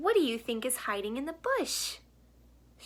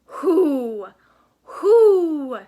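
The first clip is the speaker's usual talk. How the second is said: much louder, higher hooting owl; angry owl; 5 semitones higher, 14 dB louder than usual. Acted. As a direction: hoot it out in a fury. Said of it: "said the owl angrily".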